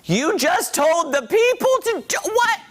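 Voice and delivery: high, slow disbelieving question; stunned at what he said, pitch way up; mock outrage in disbelief; incredulous. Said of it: "And I was like".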